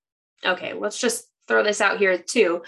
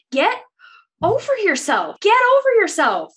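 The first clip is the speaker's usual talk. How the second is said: rising exasperated rant, higher each time; 11 semitones higher, 3 dB louder than usual. Real, not acted. Reader venting at Feyre, pitch climbing in frustration. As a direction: throw it at her, fed up.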